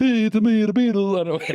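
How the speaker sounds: high mock Liverpool voice; silly British rock star voice. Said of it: "talking to his Italian accent".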